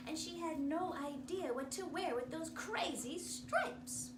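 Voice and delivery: usual speaking voice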